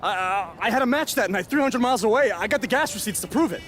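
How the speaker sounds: high, frantic protest; panicked denial. Acted. Accused wrestler protesting his innocence to his rival, high panicked denial. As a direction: blurt out a defence, pitch way up.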